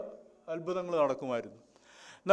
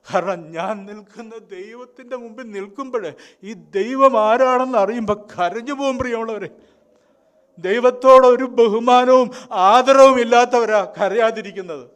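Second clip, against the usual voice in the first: loud, higher, impassioned pulpit cry; fiery sermon climax, louder and higher; full-force preaching; 4 semitones higher, 12 dB louder than usual. Real, not acted. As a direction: drive it home to the congregation.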